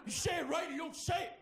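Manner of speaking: high-pitched indignant roommate, hammed up; mock angry yell. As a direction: play the roommate losing it over pronunciation. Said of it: "like clockwork".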